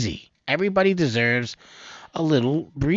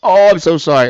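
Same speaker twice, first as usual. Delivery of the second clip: apologizing through real laughter, much higher and louder; can't stop laughing while saying sorry; 11 semitones higher, 10 dB louder than usual. Real, not acted. Host apologizes to viewers while laughing hard. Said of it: "I'm laughing".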